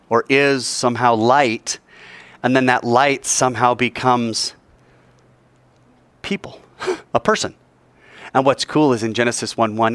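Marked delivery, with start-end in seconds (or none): 6.2-7.5 whispered aside. Real, not acted. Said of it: "unvoiced whisper".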